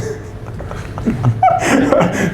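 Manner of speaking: short unvoiced laugh; breathy laugh. Real, not acted. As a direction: laugh it off lightly. Laughs at his own prediction.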